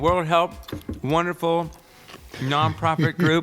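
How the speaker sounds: talking around ice in the mouth; mouth full of ice. Real, not acted. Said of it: "went into this spiel with a mouthful of ice".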